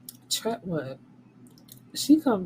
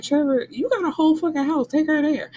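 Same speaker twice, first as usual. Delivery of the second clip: higher, fast mock telling-off; mock cussing out; 9 semitones higher, 3 dB louder than usual. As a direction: scold him like you'd cuss him out. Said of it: "I would've cussed him out".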